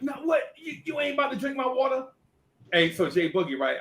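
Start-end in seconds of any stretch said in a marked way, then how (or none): 0.0-2.1 put-on high indignant teen girl; guard your water; girl's high voice. Lisa refusing to give her water, high pitched. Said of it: "She like".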